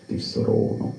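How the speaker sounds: very soft, unvoiced whisper; whispered product name. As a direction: breathe it out like a liqueur slogan. Repeating the whispered liqueur name.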